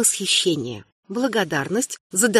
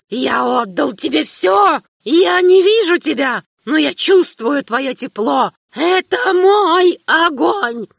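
put-on high, slow, cracked old man's shout; a dying king clinging to his fire; old emperor shouting; 7 semitones higher, 4 dB louder, slower than usual. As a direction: scream it like a betrayed old king. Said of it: "закричал старый император".

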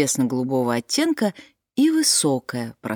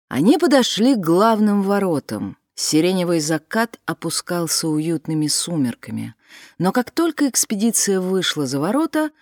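ordinary delivery, nothing marked

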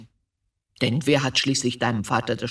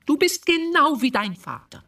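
sharp, much higher accusation; accusing outburst; 10 semitones higher than usual. Acted. Mother hurls the charge at her son, high and sharp. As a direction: throw the comparison at him.

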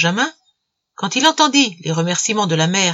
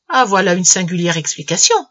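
ordinary delivery

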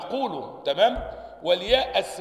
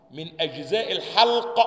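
usual speaking voice

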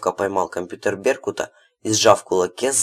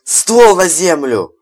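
shouted order; 9 semitones higher, 10 dB louder, slower than usual. A narrator's reading. Barking order at gunpoint.

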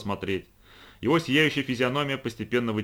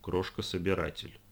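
much softer, to himself; under his breath; 3 semitones lower, 7 dB quieter than usual. A narrator's reading. Capista repeating the name over the machine, murmured. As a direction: murmur it to yourself. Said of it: "вполголоса как бы про себя повторил".

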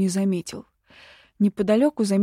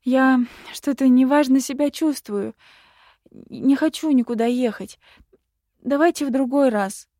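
put-on anxious little girl, pitched up; nervous bleating; 5 semitones higher, 4 dB louder than usual. A narrator's reading. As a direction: bleat it nervously, a touch higher. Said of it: "проблеяла девочка от волнения".

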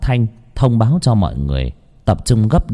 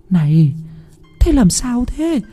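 much higher, anxious motherly voice; worried mother asking. A narrator's reading. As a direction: ask your scared son what's wrong. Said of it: "liền hỏi".

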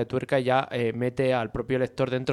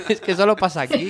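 laughing; 8 semitones higher, 5 dB louder, faster than usual. Real, not acted. Laughing about the fake accents.

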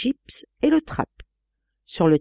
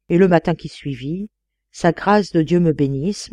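ordinary delivery

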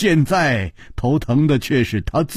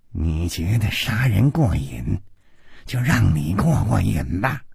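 low, cold character voice; 4 semitones lower than usual. A narrator's reading. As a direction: say it coldly and low. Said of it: "冷冷地说".

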